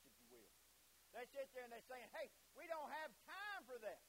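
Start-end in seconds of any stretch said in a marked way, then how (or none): 2.1-4.0 slow, high-pitched mock refusal; acted brush-off. Preacher voicing those turning Jesus away, dismissive. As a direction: wave them off, drawn out and raised.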